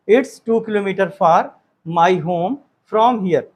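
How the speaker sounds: very slow, word by word for the class; slow dictation. Shown as a demonstration. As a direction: spell it out slowly so students can write. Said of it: "तो हमें it से ही start करना है".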